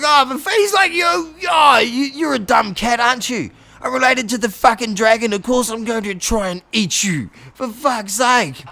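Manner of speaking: high, loud mock rant; a hammed-up furious crocodile; angry crocodile yell. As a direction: yell at the dumb cat. Crocodile snaps at cat.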